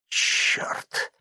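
sharp whispered oath; whispered curse. A narrator's reading. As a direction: curse under the breath. Hears a bolt hit flesh.

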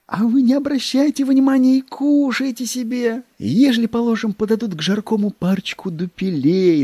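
high, eager sycophant clerk; fawning secretary voice. Acted. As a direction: coax like a fawning little clerk.

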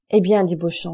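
plain reading voice

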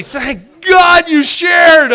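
loud, high, stretched-out relief; effusive thanks. Retold or imitated. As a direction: gush it slowly at full volume. Acts the group's grateful response.

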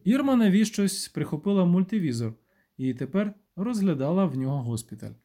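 ordinary delivery

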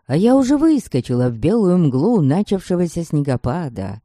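ordinary delivery

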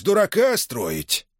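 high, slow sneering throwback; mocking anger. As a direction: throw the words back, sneering.

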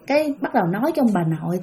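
ordinary delivery

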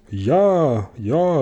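drawn-out, lifted yes; calling in chorus. A narrator's reading. The passengers answer Grashüpfer's question together, lifted calling. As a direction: answer eagerly, stretching each word. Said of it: "riefen sie im Chor".

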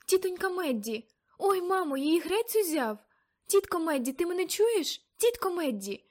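high, loud panicked calls; frightened calling. Acted. Girl calling to her frozen aunt, panicked and loud. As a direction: cry out to wake her in alarm.

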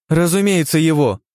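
sharp, high, cutting retort; merciless. A narrator's reading. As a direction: slam the answer down hard. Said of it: "нещадно припечатала вторая княгиня".

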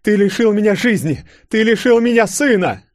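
high, loud screamed charges; furious accusation. A narrator's reading. Mother aims pistol at Gromov, screaming in fury. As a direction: scream each charge at him.

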